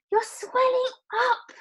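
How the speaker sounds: very high, loud scream. Acted. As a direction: scream in horror. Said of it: "screamed Missus Violet again".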